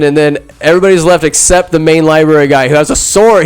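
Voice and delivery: normal talking voice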